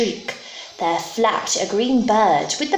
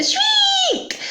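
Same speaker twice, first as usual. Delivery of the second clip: very high, drawn-out squeal; bird shriek. Acted. Beast's terrible voice described, piercing high shriek. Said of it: "its terrible voice".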